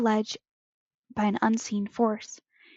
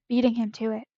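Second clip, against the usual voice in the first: ordinary delivery, nothing marked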